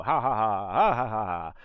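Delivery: loud staccato ha ha; revving laugh, punchy and loud; motorcycle laughter. Shown as a demonstration. Demonstrating the motorcycle game again, loud laugh bursts. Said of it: "and you go".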